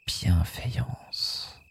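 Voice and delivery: very slow whispered Bienveillance; soft spa whisper. Shown as a demonstration. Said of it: "echo of La bienveillance".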